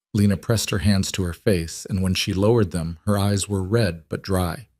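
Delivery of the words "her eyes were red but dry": ordinary delivery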